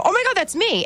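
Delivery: high, startled realization; surprised. Retold or imitated. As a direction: squeal it out in surprise. Surprised at hearing her name.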